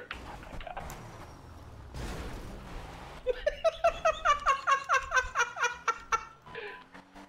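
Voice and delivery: high hysterical laugh. Real, not acted. Robbery gone wrong, hysterical laugh.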